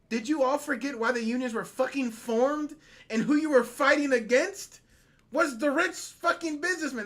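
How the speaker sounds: real indignant tirade, punching key words; angry berating. Real, not acted. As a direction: scold them hard, pitch up. Berating union voters for their choice.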